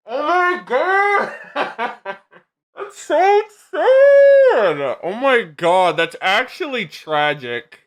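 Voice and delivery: high, stretched-out dramatic wail; over-the-top wail. Real, not acted. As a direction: wail it like your heart just broke, hamming it up. Mock despair at sad story.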